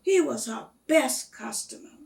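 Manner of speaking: plain reading voice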